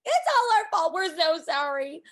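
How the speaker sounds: high, loud simpering sorry; hammed-up groveling; mock grovelling apology. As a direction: overdo the apology. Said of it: "Whitney and Heather are like".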